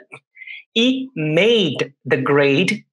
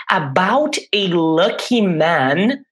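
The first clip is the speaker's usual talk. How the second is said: slow model reading; much slower than usual. Shown as a demonstration. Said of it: "presta atenção nesta pronúncia aqui".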